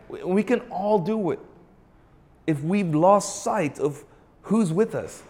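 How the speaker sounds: usual speaking voice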